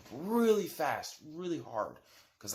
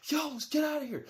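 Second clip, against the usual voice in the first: higher, urgent call to leave; friend urging escape; 13 semitones higher than usual. Retold or imitated. Friend urges him to flee the closet, high urgent call. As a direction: yelp it out to a friend. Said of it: "my friend's like".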